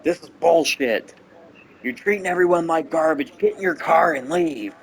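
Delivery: high, loud commanding yell; grandpa getting mad. Retold or imitated. Grandpa shouts at his son to go home, loud angry yell. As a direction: order him out of the house at full volume. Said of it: "he's starting to get mad".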